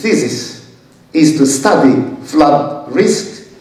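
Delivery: measured academic reading with lively rises on phrases; formal recitation with emphatic, lifted key words; formal read-aloud. Real, not acted. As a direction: read the thesis out deliberately, phrase by phrase. Reads thesis text aloud.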